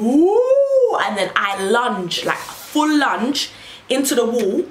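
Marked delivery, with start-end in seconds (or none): 0.0-1.0 loud high whoop to make a scene; whooping to get noticed in A and E; mock labour whoop. Woman in A and E making a scene, loud high whoop. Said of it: "So I start going".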